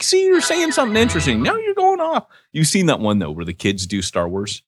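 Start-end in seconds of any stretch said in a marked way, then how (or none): 0.0-2.3 high fast mock mentor voice. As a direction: rattle off a mentor's nagging. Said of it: "He's like".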